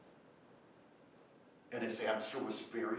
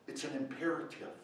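ordinary talk

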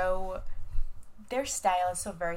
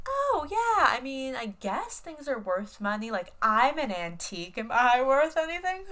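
put-on sweet old woman; 5 semitones higher, 5 dB louder than usual. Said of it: "oblivious role of".

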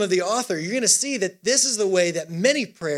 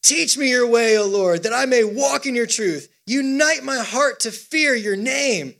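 raised, emphatic proclamation; psalm reading with gusto; 4 semitones higher than usual. Acted. Preacher urging a sluggish congregation on, reading with gusto. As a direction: declaim it boldly to rouse the room.

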